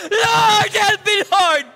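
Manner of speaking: high, loud desperate cry; crying out to God. Shown as a demonstration. Pastor shows the congregation how to cry out, a loud plea. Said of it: "ay sumisigaw kay Lord".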